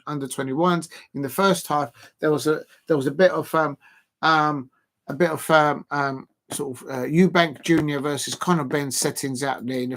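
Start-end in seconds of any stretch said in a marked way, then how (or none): none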